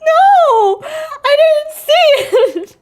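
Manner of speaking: very high, loud drawn-out wail; slow, high-pitched yell of protest; dismayed wail. Real, not acted. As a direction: cry out in comic dismay. Upset at missing the message.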